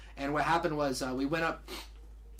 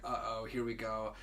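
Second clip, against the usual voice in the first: quiet inner thought; 7 semitones lower, 9 dB quieter than usual. Retold or imitated.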